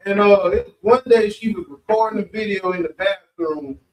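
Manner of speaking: usual speaking voice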